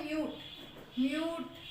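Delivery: म्यूट with a long ऊ; long vowel. Shown as a demonstration. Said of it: "long ऊ pronounce हम कर रहे हैं".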